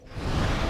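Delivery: breathy, voiceless chuckle; amused puff of laughter; brief laugh. Real, not acted. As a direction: let out a short, quiet laugh. Amused by game trivia.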